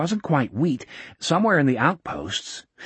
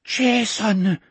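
awed cry; 8 semitones higher than usual. A narrator's reading. Old man recognizes Jason.

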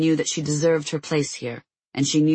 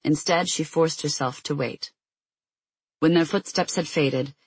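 usual speaking voice

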